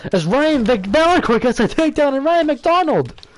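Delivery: excited. Real, not acted. Sudden takedown in fight.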